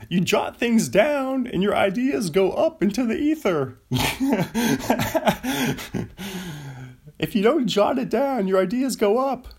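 high, loud yelling Seinfeld bit; Seinfeld impression. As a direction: whip it up high and loud like a stand-up. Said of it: "this is my Seinfeld".

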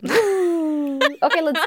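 high cheer before the show starts; excited whoop. Real, not acted. Host cheering to start the episode, excited whoop. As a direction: whoop to kick things off.

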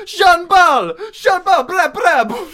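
high, loud Jamaican dancehall chant. Pretend. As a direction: shout his name like a dancehall hype man.

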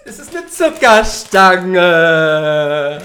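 very slow, loud announcement; drawn out. Real, not acted. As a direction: stretch every word out. Announcing the gift slowly.

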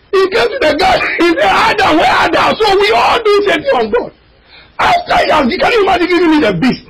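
fiery preaching. Acted. Preacher crying out to the congregation, fiery. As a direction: preach it out, voice cracking high.

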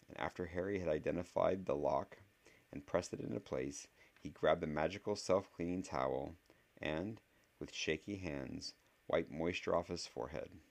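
ordinary delivery

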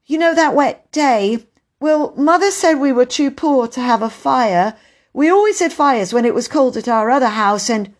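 put-on high, earnest child's account; boy explaining himself. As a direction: confide your reason, pitched high. Peter explains the lack of fire.

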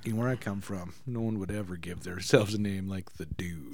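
low, soft cowboy narrator voice; Sam Elliott impression. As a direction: drop low and quiet like an old cowboy. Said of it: "when Sam Elliott's like".

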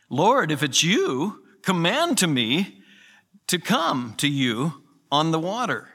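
Peter's eager request, stretched out; eager plea. Retold or imitated. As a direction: call out boldly to the Lord.

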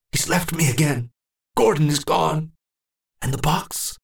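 high, aching grief; abandoned old man; anguished lament. Acted. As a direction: mourn being left again. Old man anguished Gordon left.

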